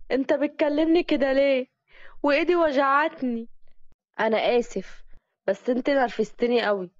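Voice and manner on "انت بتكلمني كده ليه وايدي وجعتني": higher, pained protest; hurt protest. Acted. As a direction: pull back and complain that he is hurting you. Girl protests, her hand hurts. (احتجاج متألم بصوت أعلى)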